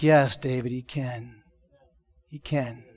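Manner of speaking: soft, gentle yes to a broken man; tender reassurance. Retold or imitated. Pastor reassuring the weeping deaf man, softly and tenderly. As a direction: comfort him quietly.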